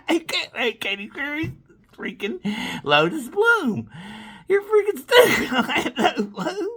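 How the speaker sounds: high, slow sneering jeer; mocking laughing taunt. Taunting Orlando Bloom.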